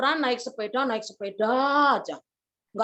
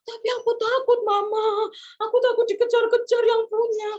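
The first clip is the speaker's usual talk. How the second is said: put-on high-pitched wailing child; mock crying; 7 semitones higher, 4 dB louder than usual. Boy telling mother he fears the car owner, crying. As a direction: bawl it out in fear. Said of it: "jangan nangis dulu".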